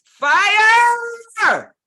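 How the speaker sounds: loud, long drawn-out alarm cry; yelling fire. Shown as a demonstration. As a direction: bellow it so nobody can ignore it. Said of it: "to yell fire".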